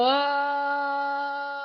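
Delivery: long, steady vocalised ah; sustained "ah". Shown as a demonstration. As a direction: hold an open ah on one breath. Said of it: "we're gonna do sounds as we fold".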